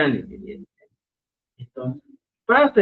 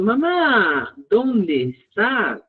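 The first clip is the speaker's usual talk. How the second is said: raised, drawn-out call; calling for mum; 5 semitones higher, much slower than usual. Acted. Child asking mamá where she is, high drawn-out call. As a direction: stretch it out slowly as if calling mother.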